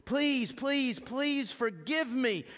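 mock desperate pleading prayer; begging. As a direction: beg God for forgiveness desperately. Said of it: "Have you ever said that".

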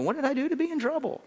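high, fast indignant whine; mock innocent. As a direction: play the wounded innocent, quick and high. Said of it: "you go".

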